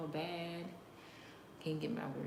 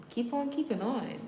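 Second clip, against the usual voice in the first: higher, louder mock cheer; 8 semitones higher, 6 dB louder than usual. Real, not acted. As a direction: sarcastically egg them on. Mocking encouragement to trolls.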